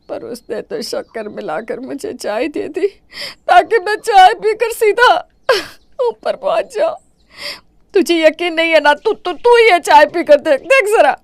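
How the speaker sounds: high, tearful accusing wail; hammed-up sobbing. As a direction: play the victim, voice breaking. Mother-in-law's crying complaint. (बेटे से बहू की शिकायत करते हुए नकली रोना)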